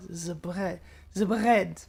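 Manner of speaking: German accent, practising the R in "bread"; careful German accent, "The" as "ze"; attempted German accent. Shown as a demonstration. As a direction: try "bread" with an untrilled German R. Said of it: "Just don't build your tongue when you say an R".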